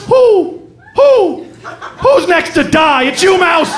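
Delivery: high hooted shout, drawn out; menacing owl. Acted. Owl to a mouse from its tree, menacing high hoot.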